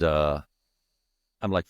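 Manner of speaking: ordinary delivery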